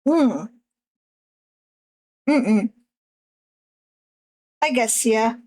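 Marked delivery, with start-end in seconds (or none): none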